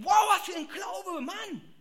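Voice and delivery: high, loud, slow awe-struck shout; amazed shout. Retold or imitated. Preacher reading his Bible, amazed loud shout. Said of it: "denk so".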